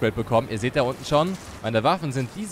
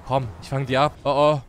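ordinary talk, nothing marked

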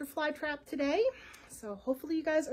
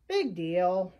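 unimpressed; 6 semitones lower, 3 dB louder than usual. A narrator's reading. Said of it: "secretly excited".

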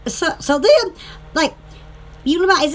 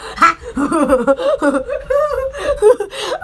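high yelping laugh; squeaky dog-like laugh; 4 semitones higher than usual. Real, not acted. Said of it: "I sound like a dog like that".

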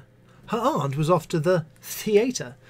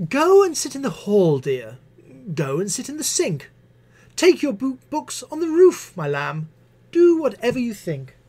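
higher, exasperated teacher voice; weary teacher; 9 semitones higher, 4 dB louder than usual. Acted. Missus Butler fobbing off the pupil, weary sweetness. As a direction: brush the child off sweetly.

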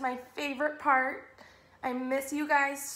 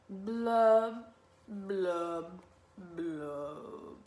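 three drawn-out blubs stepping downward; glum fish blubs; 6 semitones lower, much slower than usual. Acted. Gloomy pout-pout fish ending the refrain, glum descending blubs.

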